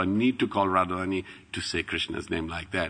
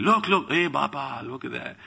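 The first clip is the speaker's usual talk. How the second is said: excited; 6 semitones higher than usual. Retold or imitated. Excited families spotting fish.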